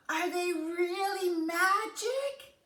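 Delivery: eager question. Acted. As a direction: ask with wide-eyed hope. Jack asks eagerly.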